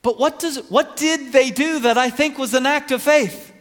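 loud, high, emphatic declaration; impassioned preaching. Real, not acted. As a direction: proclaim it forcefully, leaning on each phrase. Preaches at full force.

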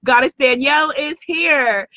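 ordinary delivery

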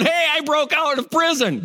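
high, cheery boast; mock bragging. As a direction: blurt the escape like good news. Imagined bragging escapee.

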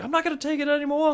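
fast, high bad Australian accent; fed-up wildlife host in a bad Australian accent; terrible Australian accent. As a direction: rattle it off in a hammed-up Aussie accent. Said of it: "That's my terrible Australian accent".

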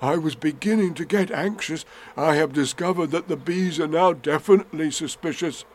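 a hint of an earnest bear; Winnie the Pooh. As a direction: confide it earnestly as a storybook bear. Voices Pooh in story.

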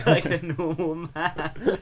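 slow and loud through laughter; laughing. Real, not acted. Joining the co-host's Owen Smith parody, laughing. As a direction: draw it out while cracking up.

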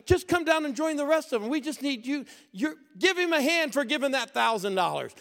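rally the room from the pulpit; preacher's call. Retold or imitated. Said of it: "He goes".

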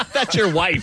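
laughing. Real, not acted. Joking about reading to wife.

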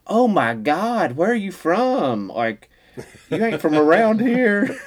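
high, loud, drawn-out small-town astonishment; slow hammed-up country gawker; Tazewell local. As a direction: imitate a stunned local, stretching every word. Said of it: "I drove to Tazewell".

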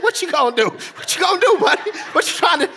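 high, laughing tease at the fussing son; playfully mock the cranky little boy, laughing; teasing toddler voice.

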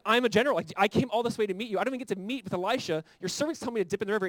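loud, fast indignant rant; furious general. Retold or imitated. Naaman fuming at the servant, loud and fast. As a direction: general insulted by a servant, ranting. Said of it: "he's furious".

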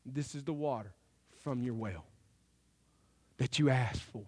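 quiet, humble presentation of the cup; hushed offering. Acted. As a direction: hand it over softly. Men hand David the water.

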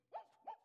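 high playful woof woof; hammed-up dog barking; dog barks. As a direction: bark like a friendly dog. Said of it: "interrupts the dog".

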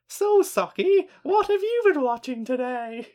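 very high, slow, bubbly presenter; morning TV host voice. Acted. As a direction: gush like a daytime TV host. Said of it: "like eleven A M T V host".

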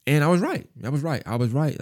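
proud that he called it; triumphant. Real, not acted. Telling listeners his optimism about his friend was right, triumphant.

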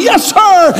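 ordinary delivery

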